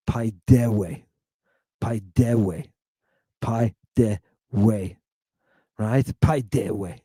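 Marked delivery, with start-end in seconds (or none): 0.1-2.7 Pi de we joined up with the weight on DE; pi-DE-we, falling at the end; stress on middle syllable. Shown as a demonstration. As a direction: lean on de in pi-DE-we. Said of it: "That's where your accent is".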